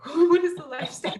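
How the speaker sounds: high, loud, mock disbelief; incredulous retort. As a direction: toss it back in amused disbelief.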